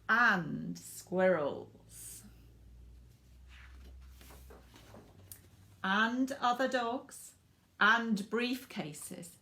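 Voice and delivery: drawn-out list. A narrator's reading. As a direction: savour each item for comic effect.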